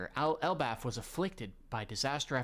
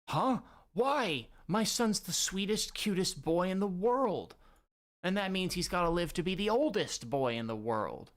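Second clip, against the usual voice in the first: higher, louder protective mom; doting angry mother; 7 semitones higher, 3 dB louder than usual. Acted. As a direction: defend her little boy with fierce love. Voices Ida defending her son.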